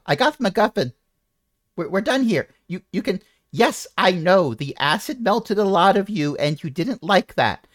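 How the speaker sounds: high excited NPC voice. Acted. NPC urging ally to leave.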